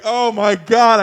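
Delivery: hammed-up shocked cry; mock horror. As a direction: gasp it out in dismay. Retold horror at scene.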